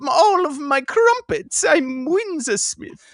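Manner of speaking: high, loud, slow mock-weepy British accent; posh British accent. Host hamming up a British accent for his cohost, loud and wailing. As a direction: wail about crumpets like a stuffy Englishman. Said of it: "a guy whose last name is Windsor".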